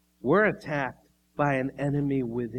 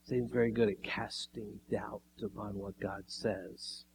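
whisper; 5 semitones lower, 10 dB quieter than usual. Real, not acted. Sudden aside after Satan's challenge.

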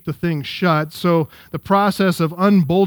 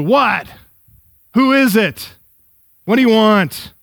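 grumpy neighbor; 4 semitones higher, 4 dB louder than usual. Acted. Sleepy neighbor woken by knocking.

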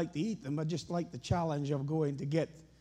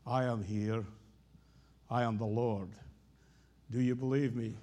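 much lower, calm voice of God; voice of the Lord; 7 semitones lower than usual. Pretend. The Lord answering the hanging man, low and calm. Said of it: "following conversation ensued".

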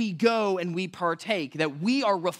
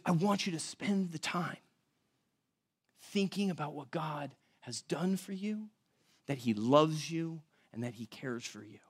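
tender hush; 3 semitones lower, 9 dB quieter than usual. Retold or imitated. Jesus voiced at the Last Supper.

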